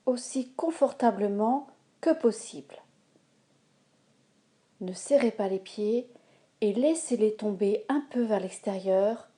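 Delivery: ordinary talk, nothing marked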